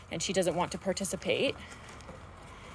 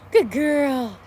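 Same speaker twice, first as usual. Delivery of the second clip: higher, louder happy praise; excited happy praise; 6 semitones higher, 7 dB louder than usual. Real, not acted. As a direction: gush over the dog. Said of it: "use my excited, happy voice".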